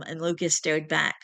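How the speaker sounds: ordinary delivery, nothing marked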